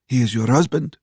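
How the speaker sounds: high, questioning waitress voice. A narrator's reading. Waitress voiced in narrated dialogue.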